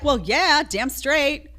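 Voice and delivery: high, loud mock self-assurance; imitated proud agreement. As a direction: imitate someone brazenly owning it. Imitating the woman proudly owning the insult.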